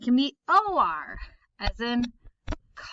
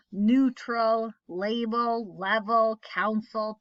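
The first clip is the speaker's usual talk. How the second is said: ordinary talk, nothing marked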